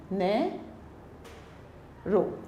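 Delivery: Narrow very soft and slow, नै, long gap, रो; syllable by syllable. Shown as a demonstration. Teacher counting vowels, narrow softly split into नै and रो. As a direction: break narrow quietly into two separated syllables. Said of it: "ओ की voice आ रही है".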